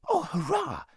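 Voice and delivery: put-on very high boyish hurrah; high-pitched jubilation; excited cheer. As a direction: cheer with delight, voice leaping up. Boy cheers at going north.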